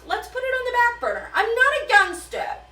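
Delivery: mock Gale voice. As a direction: squeak it out quickly as a goofy Gale. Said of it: "And Gale goes".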